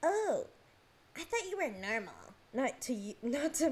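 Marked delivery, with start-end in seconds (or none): 0.0-2.1 higher, louder "Oh" of feigned shock; a touch of mocking disappointment; mock surprise. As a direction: imitate him being let down, lightly. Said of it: "he's said before".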